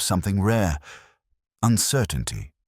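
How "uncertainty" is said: plain reading voice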